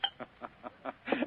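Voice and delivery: quiet, low amused chuckle; a pleased little laugh under the breath; soft chuckle. Acted. Gutman chuckles at Spade.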